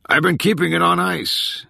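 jokey holler to the staff; calling back. A narrator's reading. Boss jokes back to yelling crew.

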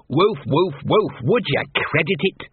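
spoken woofs in a grumbling dog voice; talking dog. Acted. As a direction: bark in words, then complain. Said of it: "Gaspode had found he did tend to get heard".

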